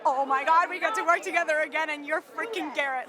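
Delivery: loud, gushing retold text message; thrilled shouty outburst, retold; all-caps excitement. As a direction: blurt it out like a text typed in capitals. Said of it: "in all caps".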